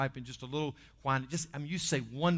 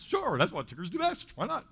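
lightly voiced eager cartoon character, higher pitch; Tigger; 6 semitones higher, 3 dB louder than usual. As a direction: pitch it up for a game, carefree answer. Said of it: "Tigger's always a, "Why not?".

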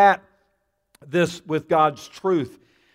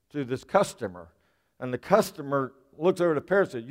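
ordinary delivery

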